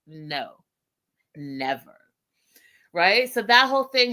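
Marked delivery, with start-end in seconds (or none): 0.1-2.0 low, quiet dismissive no; low flat refusal. Retold or imitated. Josh refusing Natalie, low quiet refusal. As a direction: drop it low and shut her down. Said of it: "And he's like".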